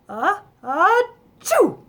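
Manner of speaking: slow, loud, high wind-up then achoo; hammed-up sneeze; big sneeze. A narrator's reading. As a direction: build the tickle then sneeze hard. Sneezing at the bowl.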